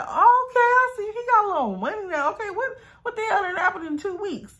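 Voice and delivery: very high, incredulous squeal; exaggerated impressed disbelief; amused amazement. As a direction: voice leaping up, astonished and tickled. Acted amazement at his sudden money.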